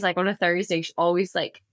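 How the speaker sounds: ordinary delivery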